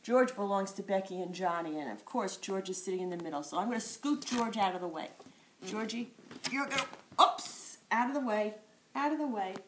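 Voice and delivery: usual speaking voice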